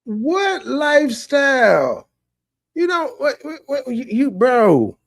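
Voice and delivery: slow, loud, high incredulous shout; shocked shout. Real, not acted. Cohost shouting at his partner in disbelief over his lifestyle. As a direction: bellow it in disbelief.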